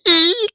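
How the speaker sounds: high squeaky motor eek; tiny eek. Retold or imitated. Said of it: "He makes that eek sound".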